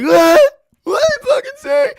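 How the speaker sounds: very high, strained imitated shriek; hammed-up screamed challenge; mock screaming retort. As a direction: yell back like the outraged, hysterical kid. Imitates screaming kid yelling back.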